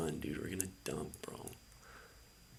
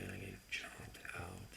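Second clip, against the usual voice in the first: defeated mutter; 8 dB quieter than usual. Real, not acted. Defeated end of session.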